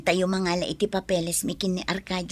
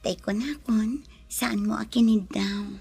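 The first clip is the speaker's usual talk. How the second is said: whisper. Acted. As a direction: breathe the words close to his ear. Intimate whisper to lover. (pabulong na malambing)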